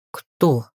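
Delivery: low, puzzled query; stunned bewilderment. A narrator's reading. Witch looking at crow, low bewildered question. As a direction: drop the pitch in bafflement. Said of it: "ошарашенно переспросила с недоумением".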